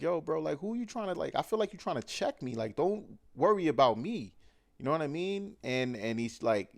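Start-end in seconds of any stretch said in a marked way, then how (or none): 0.0-4.3 defensive quote. Retold or imitated.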